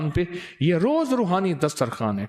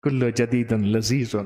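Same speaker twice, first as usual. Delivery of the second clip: slow low motto; 6 semitones lower, slower than usual. Shown as a demonstration. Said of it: "motto low slow".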